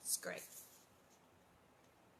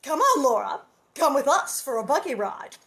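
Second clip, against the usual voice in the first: put-on loud, eager girl calling to Laura; Nellie crying out; 3 semitones higher, 10 dB louder than usual. Nellie calls to Laura from the buggy, loud and eager. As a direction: call out brightly to a friend. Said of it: "Nellie cried".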